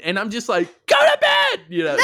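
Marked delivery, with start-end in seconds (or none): 0.9-1.6 high, loud, laughing shout; laughing yell at his brother across the room. Retold or imitated. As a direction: shout at him to go to bed. Yelling at brother to sleep.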